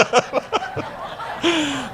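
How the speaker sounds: a real, high, airy laugh; real embarrassed laugh. Real, not acted. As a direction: laugh off the surprise. Laughs at running out of time.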